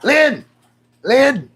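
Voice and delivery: high, loud playful cry for help; mock alarmed yell. Streamer calls Lynn about his bleeding ear, mock alarmed yell. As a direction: yell for your partner in pretend panic.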